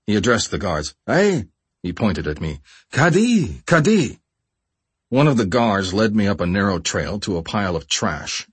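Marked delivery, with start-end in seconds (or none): 1.1-1.5 high, sharp call for attention. A narrator's reading. Fellow captive hails the guards, calling out high. 2.9-4.2 high, insistent repeated call. A narrator's reading. Fellow captive tells the guards what the hostage needs, calling out.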